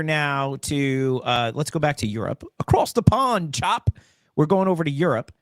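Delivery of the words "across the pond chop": a quick, hammed-up British accent, a bit higher; bad British accent. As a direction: throw in a terrible British accent. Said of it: "That was a terrible accent".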